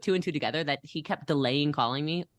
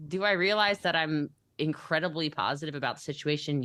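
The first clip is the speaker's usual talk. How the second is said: normal talking voice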